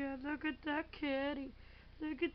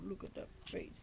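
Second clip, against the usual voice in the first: whisper; 9 semitones lower, 10 dB quieter, faster than usual. Real, not acted. Whispering to the cat.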